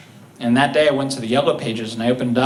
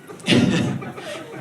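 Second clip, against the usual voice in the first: breathy laugh. Real, not acted. Laughs at his dark story.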